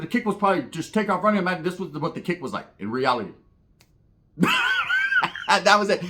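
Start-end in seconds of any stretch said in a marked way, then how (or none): 4.4-5.4 big laugh. Real, not acted. Laughing at his own bit.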